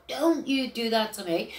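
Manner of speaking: usual speaking voice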